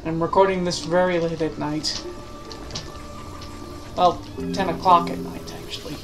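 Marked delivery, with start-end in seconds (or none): none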